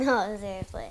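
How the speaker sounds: usual speaking voice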